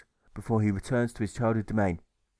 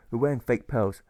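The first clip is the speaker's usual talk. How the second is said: ordinary delivery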